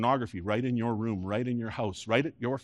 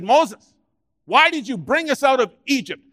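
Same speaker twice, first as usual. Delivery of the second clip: whiny complaint, higher and louder; grumbling Israelites; 11 semitones higher, 8 dB louder than usual. Acted. Israelites griping at Moses in the desert, whining loudly. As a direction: moan at Moses like ungrateful wanderers. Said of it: "they began to grumble".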